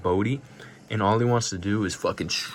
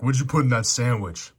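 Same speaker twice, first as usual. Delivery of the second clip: louder, higher mock tough-guy line; hammed-up action-movie menace; 4 semitones higher, 6 dB louder than usual. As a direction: bark it like a dumb movie heavy. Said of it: "he's like".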